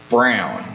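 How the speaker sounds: "brown" set apart, dropped low; stress on brown. Shown as a demonstration. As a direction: land hard and low on "brown". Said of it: "let me emphasize the word".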